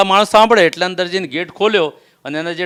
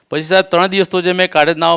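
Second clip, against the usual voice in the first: normal talking voice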